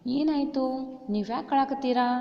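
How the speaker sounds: high, loud concerned queen; Kunti asking. A narrator's reading. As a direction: call out with worried concern. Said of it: "Kunti ಕೇಳಾಕತ್ತಾಳ".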